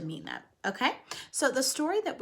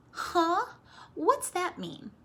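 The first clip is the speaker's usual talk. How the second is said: much higher, voicing a kid's question; teacher voicing a child asking; mock puzzled child; 9 semitones higher, 3 dB louder, slower than usual. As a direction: play a confused student. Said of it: "I'm so glad you asked".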